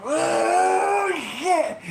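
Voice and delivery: Barry's shocked scream as Natalia turns; panicked shout. Retold or imitated. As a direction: yell it in sudden alarm. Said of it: "Barry breaks out".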